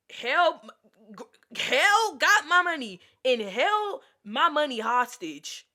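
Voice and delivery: high, loud outraged emphasis; emphatic. Real, not acted. Stressing how the friend kept her money. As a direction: hammer the word held. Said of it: "I'm talking".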